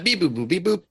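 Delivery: playful high-pitched beep-boop noises; mimed electronic beeps. Pretend. Player pretending to program the comm, playful beeping. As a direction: imitate a little gadget being programmed.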